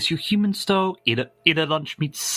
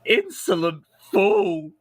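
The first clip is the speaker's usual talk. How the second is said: evil queen. Acted. Viewer message scolding host.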